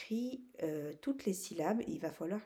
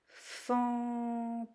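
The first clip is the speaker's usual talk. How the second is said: "fantôme" higher, very drawn out; syllable by syllable; 5 semitones higher than usual. Shown as a demonstration. Teacher naming the last picture, syllable by syllable. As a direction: stretch "fan", "tô", "me" on a held pitch. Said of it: "Trois syllabes".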